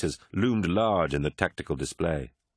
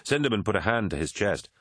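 ordinary delivery, nothing marked

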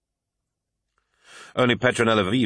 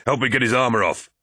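shout; 5 semitones higher, 3 dB louder than usual. A narrator's reading. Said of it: "he shouted".

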